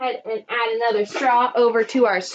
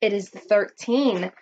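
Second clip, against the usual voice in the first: normal talking voice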